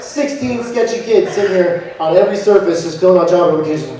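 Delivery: ordinary talk, nothing marked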